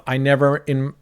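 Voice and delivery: usual speaking voice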